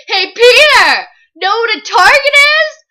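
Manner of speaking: loud, high crowing comeback; acted gloating yell; triumphant taunt. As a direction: throw the taunt right back at full volume. Tris throws the taunt back.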